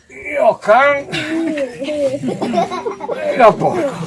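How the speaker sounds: cursing grumpy worker; muttered curses. Acted. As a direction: grumble curses while walking. Friulano cursing.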